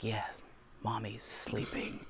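hammed-up grieving father, hushed; president's reply. Mocking the president answering his daughter, soft slow murmur. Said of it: "And then he's like".

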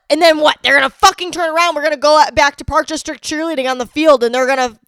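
loud, high, fast outraged venting; furious disbelief at a dumb plan; indignant rant. Real, not acted. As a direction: vent like you still can't believe it.

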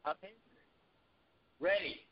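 distant phone voice; faint, away from the mic; phone held away. Real, not acted. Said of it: "just make sure you speak into the phone".

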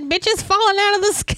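playful squealed exclamation; mock exclamation. Quoting own reaction, laughing.

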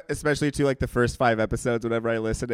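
ordinary talk, nothing marked

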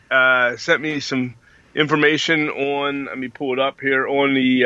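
normal talking voice

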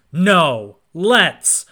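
loud, punched retort; retold fighting challenge, punched out; defiant movie line. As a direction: throw it down like a cheesy action line. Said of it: "and then she goes".

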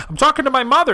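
high, mock-innocent husband voice; cornered husband. Retelling the husband fibbing to his wife, mock innocent. Acts husband covering up on phone.

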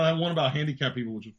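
ordinary talk, nothing marked

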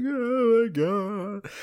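very high, wailing whimper; hammed-up helpless cry to a big sister; mock crying. Answering sister's call while sick, mock crying. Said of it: "that's how I sounded".

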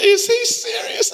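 very high, slowed-down disbelief; incredulous bully. Retold or imitated. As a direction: turn to the crowd in high mock disbelief. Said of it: "he turns around and says".

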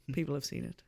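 low, whispered insistence; whispered aside. Real, not acted. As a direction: whisper it as a comic afterthought. Comic undercut after admitting not seeing.

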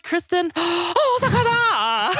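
put-on high, slow, laughing pseudo-Japanese accent; "Osakata" drawn out high and slow with a pseudo-Japanese lilt; mock pseudo-Japanese accent. Teasing Brian by copying his pseudo-Japanese accent on "Osakata". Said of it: "You're like".

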